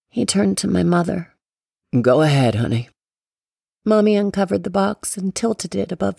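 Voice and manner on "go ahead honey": put-on low, gentle old man; deep, coaxing grandfather voice; grandpa voice. A narrator's reading. Grandpa urges the mother.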